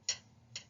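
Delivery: short unvoiced taps, t t t; tapping T sound. Shown as a demonstration. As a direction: tap the tongue, t. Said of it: "The next one is T".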